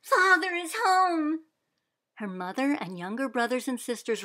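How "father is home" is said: high, loud, slow cry of joy; joyful shout. Acted. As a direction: call it out to the house. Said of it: "she shouted".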